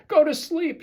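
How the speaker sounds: high, loud shout. Acted. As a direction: shout it out in triumph. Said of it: "shouted Toad".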